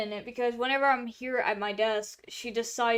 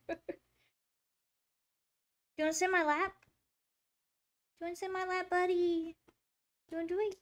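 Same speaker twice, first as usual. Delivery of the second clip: higher, sing-song pet talk; 7 semitones higher, much faster than usual. Real, not acted. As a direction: coax the kitty, high and sweet. Baby talk to her cat.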